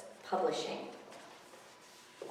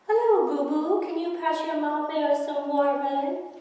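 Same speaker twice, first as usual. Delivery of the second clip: much higher, louder sugary mom voice; fake mom voice; 10 semitones higher, 8 dB louder than usual. Gary posing as the kid's mom in a high cooing voice. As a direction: coo like a fake mother.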